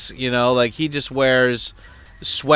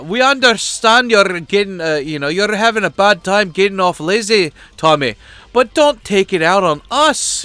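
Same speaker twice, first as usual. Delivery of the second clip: higher, louder sing-song mock sympathy; mocking put-on voice; 7 semitones higher, 4 dB louder than usual. Mocking the angry Facebook guy, high patronising voice. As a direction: patronise him in a higher singsong voice.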